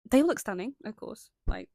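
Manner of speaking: normal talking voice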